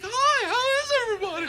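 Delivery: very high, loud, over-the-top astonished greeting; permanently surprised. Acted. Returning after eyebrow surgery, comically surprised. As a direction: squeal hello as if stuck in shock. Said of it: "I look fucking surprised all the time".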